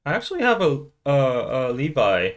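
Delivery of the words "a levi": usual speaking voice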